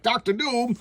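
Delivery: high, booming-up fanfare for a villain; dramatic reveal. Acted. Comic reviewer announces Doctor Doom's surprise entrance, dramatic reveal.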